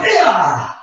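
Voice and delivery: loud, high karate battle cry; kiai shout. Acted. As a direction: let out a sharp fighting yell. Kiai shout ending kata move.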